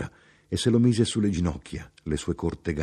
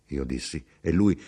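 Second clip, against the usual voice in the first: ordinary delivery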